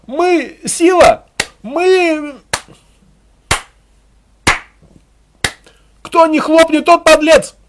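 high, loud speech to a crowd; rallying harangue. A narrator's reading. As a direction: rouse comrades with fervent slogans.

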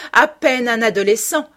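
high, loud, bitter mockery; sneering. A narrator's reading. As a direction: throw the words back with scorn. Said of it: "ricana-t-elle".

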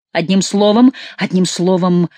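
usual speaking voice

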